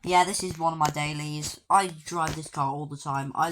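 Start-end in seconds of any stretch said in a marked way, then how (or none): none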